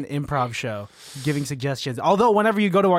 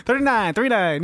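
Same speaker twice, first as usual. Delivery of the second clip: higher, punchy number call; mimicking an announcer; 6 semitones higher than usual. Teasing co-host who should work in Vegas, mimicking caller.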